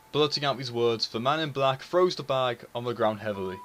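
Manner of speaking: usual speaking voice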